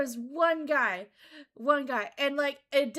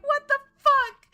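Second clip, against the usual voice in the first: high, loud, slow disbelieving cry; stunned; 13 semitones higher, 11 dB louder, slower than usual. Retold or imitated. A streamer retelling her stunned reaction, loud and high.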